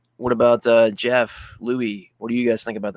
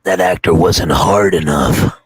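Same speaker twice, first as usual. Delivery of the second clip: deep announcer voice; 5 semitones lower, 6 dB louder, slower than usual. Pretend. Deep jokey movie voice.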